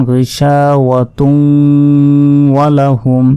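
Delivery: "গিশাওয়াতুন" slowly recited, nasal hum carried into "ওয়া"; read straight on. Shown as a demonstration. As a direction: link "গিশাওয়াতুন" to "ওয়া লাহুম" with a held hum. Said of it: "যদি আমরা একইভাবে পড়ে যাই". ("গিশাওয়াতুন" একটানা "ওয়া লাহুম"-এ মিলিয়ে)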